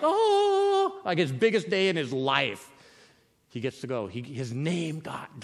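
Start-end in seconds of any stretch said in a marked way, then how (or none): 0.0-1.0 long high-pitched excited "ooh"; Zechariah's elation. Acted. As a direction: cry out at the biggest day of your life. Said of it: "This is Zechariah's like".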